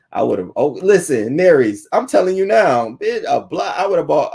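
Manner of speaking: loud, high, worked-up rant; fired up at the neighbours; heated. Pretend. Talking to the neighbours, loud and high. Imagined landlord getting in faces.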